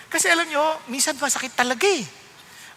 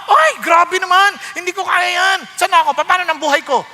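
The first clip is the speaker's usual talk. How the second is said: mock dismay; 5 semitones higher, 5 dB louder than usual. Mimicking a dismayed listener.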